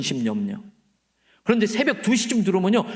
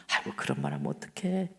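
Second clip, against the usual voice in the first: much lower, softer mock scolding; gentle chiding; 8 dB quieter than usual. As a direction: chide her tenderly, low and hushed.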